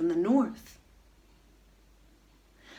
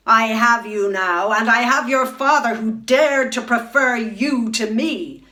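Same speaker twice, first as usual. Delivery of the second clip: loud, triumphant cruel queen; gloating queen; 3 semitones higher, 8 dB louder than usual. Acted. Queen gloats over the captured swans, loud and cruel. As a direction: crow over your captives, louder and higher. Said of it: "she gloated".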